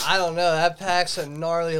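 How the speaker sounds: normal talking voice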